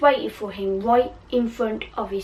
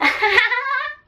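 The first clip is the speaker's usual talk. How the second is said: high evil witch cackle; shrill mad laugh; Bellatrix cackle; 13 semitones higher than usual. Acted. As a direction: cackle with villain glee. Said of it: "That laugh she always does".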